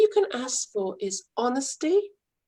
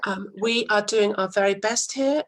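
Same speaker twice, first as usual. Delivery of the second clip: usual speaking voice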